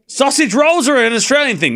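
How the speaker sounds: high, insistent outburst; indignant protest. Real, not acted. Streamer insists sausage rolls are Australian, indignant protest. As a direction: claim it with outraged certainty.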